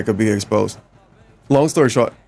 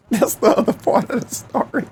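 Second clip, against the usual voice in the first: high giggling through words; laughing; 13 semitones higher than usual. Real, not acted. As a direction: laugh while telling it. Laughing at story.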